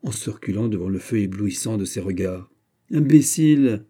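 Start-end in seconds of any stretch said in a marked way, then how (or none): 2.9-3.9 high, loud outcry; exclaimed insult. A narrator's reading. D'Artagnan to Planchet who thinks him mad, exclaimed insult. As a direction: throw it out as a sharp rebuke. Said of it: "s'écria D'Artagnan".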